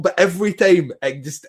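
ordinary talk

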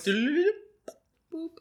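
stretched high boop, very slow. Pretend. Joking about a collapsing star, a slow high boop. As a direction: draw out boop up high like a cartoon. Sound effect joke.

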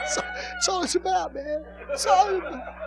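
squealing with laughter while speaking; high laughing squeal. Real, not acted. Preacher laughing at his own sex joke, high and squealy. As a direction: laugh through the words, high and breaking.

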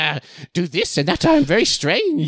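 high, drawn-out creepy henchman voice; hammed-up odd little servant, rising high; Igor. Co-host mocking Newt as a weirdo sidekick, strange high voice. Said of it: "like, Igor from the Frankenstein movies".

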